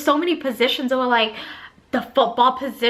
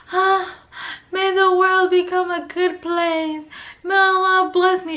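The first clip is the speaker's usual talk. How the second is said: drugged and loopy; 7 semitones higher than usual. Retold or imitated. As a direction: float through it, high on morphine. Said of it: "So with my high ass".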